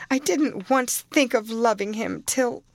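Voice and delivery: put-on girl tearfully protesting to her nurse. A narrator's reading. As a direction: plead through tears. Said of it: "sobbed Cathy".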